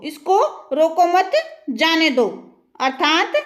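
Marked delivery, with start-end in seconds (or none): 0.7-2.3 "रोको मत" joined, then separate "जाने दो"; pause after मत. Shown as a demonstration. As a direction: keep मत with रोको, break before जाने. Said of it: "इसको रोकना नहीं है".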